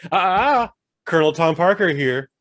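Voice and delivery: hammed-up movie voice-over interruption; Colonel Tom Parker. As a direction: butt in loudly as the story's narrator. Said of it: "the movie will just side, was like".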